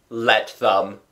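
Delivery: defiant, careless retort; reckless. A narrator's reading. Father dismisses wife's worry about servants, reckless. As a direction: toss it back recklessly. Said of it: "he answered recklessly".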